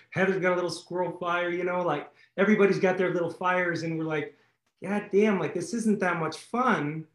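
usual speaking voice

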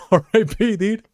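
high, giggling through the words; laughing. Real, not acted. Joking about the girl he ran over, laughing high. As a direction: laugh it out, amused.

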